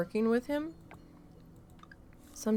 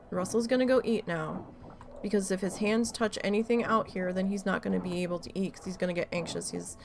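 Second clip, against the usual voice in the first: ordinary delivery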